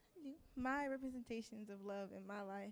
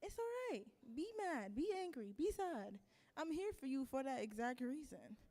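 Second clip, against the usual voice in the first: high, gentle soothing voice, lifted well above her own; a reassuring friend, lightly voiced and higher; comforting a friend; 6 semitones higher than usual. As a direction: coax a hurting friend to let it out, kindly and high. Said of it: "And it's like".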